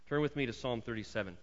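normal talking voice

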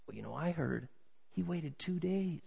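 soft, confiding gossip; hushed rumor. Acted. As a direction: pass it on like a secret. Acts out onlooker passing on rumor.

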